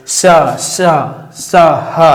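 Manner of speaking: slow drawn out शा, शा, शा then breathy हा; demo hissing sounds. Shown as a demonstration. Teacher demonstrates the friction sounds, slow hiss. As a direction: let the air rub out long on शा and हा. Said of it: "आप बोलिए शा".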